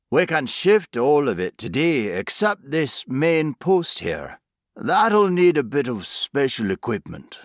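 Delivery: broad West Country workman; thick country accent. A narrator's reading. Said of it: "his country accent as thick as homemade jam".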